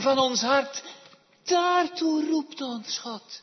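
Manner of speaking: loud, high pulpit proclamation; impassioned preaching. Real, not acted. Preacher proclaims why God calls sinners, preaching loud and high. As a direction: raise the voice and proclaim it with force.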